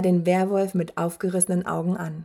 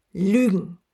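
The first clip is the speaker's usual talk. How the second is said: short, mildly barked outburst; a touch of a bark; barked word; 4 dB louder than usual. A narrator's reading. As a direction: bark it out, with some restraint. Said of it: "bellte Gmorg".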